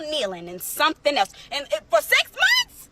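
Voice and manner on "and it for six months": incredulous. Real, not acted. Shocked at his cheating.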